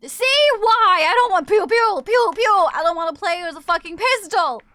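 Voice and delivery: loud, high comic outburst with silly gun noises; hammed-up exasperated yelling; mock ranting. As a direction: rant it like an exasperated kid. Ranting about the pistol mode.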